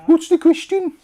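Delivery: very high, loud mock Brummy accent. Host playing Siri gone Brummy, hammed-up Brummy accent. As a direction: exaggerate a Birmingham accent, pitched right up. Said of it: "that went into Brummy then".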